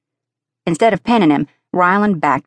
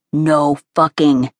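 blunt coach; slower than usual. A narrator's reading.

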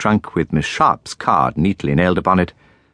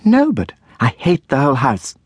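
higher, a touch of furious outburst; in a fury; 7 semitones higher than usual. A narrator's reading. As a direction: spit out her hatred of the school. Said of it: "continued Miss Sharp in a fury".

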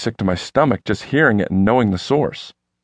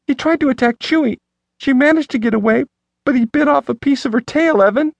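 high, panicked woman's voice; terrified wife pleading; frantic woman. A narrator's reading. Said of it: "Stephanie sobbed".

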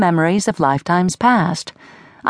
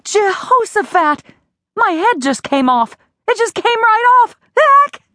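climbing higher and louder to a yelp; rising shock; 13 semitones higher, 3 dB louder than usual. Acted. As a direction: panic building to a shout.

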